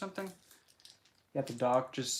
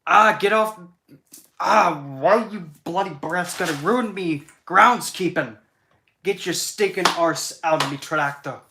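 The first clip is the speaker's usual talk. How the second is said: Groundskeeper Willie; 4 semitones higher, 11 dB louder than usual. Retold or imitated. Groundskeeper yelling at kids on his tractor, in a furious Scottish shout. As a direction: bellow at the brats in a thick Scottish brogue. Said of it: "see if I can imitate this thing".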